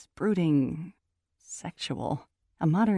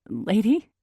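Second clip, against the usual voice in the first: higher, louder, rising question; incredulous echo; 5 semitones higher, 6 dB louder than usual. A narrator's reading. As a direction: throw the word back as an affronted question. Repeats bouncer's word as question.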